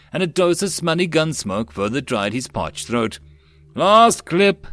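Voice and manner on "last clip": loud, high battle shout; urgent soldier's cry; hoarse call. A narrator's reading. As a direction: call down to comrades under fire. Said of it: "someone's hoarse voice scowled down".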